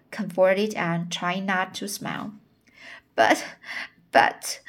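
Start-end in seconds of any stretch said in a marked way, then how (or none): 3.2-4.6 breathy, half-voiced gulping buts; gulping sob. Janet chokes on tears telling Anne, gulping sob. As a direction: choke out the words through a gulp. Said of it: "with a big gulp".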